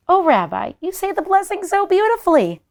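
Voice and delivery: put-on high, sweet old woman. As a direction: gush over him warmly. Baba Brina praising.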